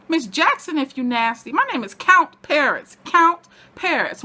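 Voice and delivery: high, loud, sassy put-down; sassy correction. Retold or imitated. Correcting her about his title with sass. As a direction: snap back with diva attitude. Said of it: "he turned around at her like".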